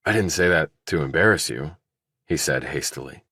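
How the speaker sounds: usual speaking voice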